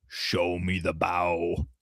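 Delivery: low, slow sinister clown voice; creepy clown voice. Retold or imitated. Pennywise posing as her father, low slow creepy demand. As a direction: drag each word out low like a monster clown.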